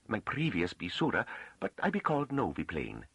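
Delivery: high-pitched rustic woman, a little nervous; humble farm woman. A narrator's reading. Farm woman gives the master her name, humbly nervous. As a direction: speak up high in halting country dialect.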